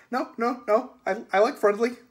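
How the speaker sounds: high, loud, stammering protest; panicked backpedalling. Acted. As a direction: blurt it out in alarm. Boogeyman frightened by Susan's threat.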